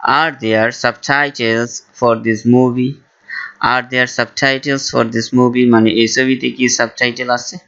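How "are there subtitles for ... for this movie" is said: very slow, careful English; slow model phrase. Shown as a demonstration. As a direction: say it slowly for learners. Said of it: "ইংলিশে বলবেন". (ধীরে ধীরে স্পষ্ট উচ্চারণে)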